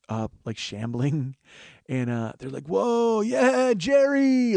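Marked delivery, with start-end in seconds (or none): 2.7-4.6 loud, high, drawn-out mock cheering; imitation of rowdy fans cheering their idol. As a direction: holler it like an elated fan, hammed up. Imitating whooping Grateful Dead fans.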